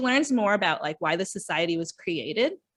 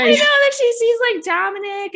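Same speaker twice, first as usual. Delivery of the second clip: excited; 13 semitones higher, 7 dB louder than usual. Real, not acted. Excited retelling the plot twist.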